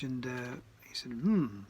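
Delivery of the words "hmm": put-on [name] accent. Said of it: "in this kind of [name] accent".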